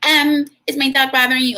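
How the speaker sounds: imitating an annoyed dog owner with sing-song disapproval; passive-aggressive Karen. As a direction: play the fussy dog owner needling the parents. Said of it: "Like, giving all that energy".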